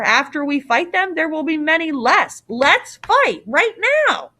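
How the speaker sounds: high, insistent rallying cry; young hero itching for battle; fired up. Acted. Theseus urges father to fight.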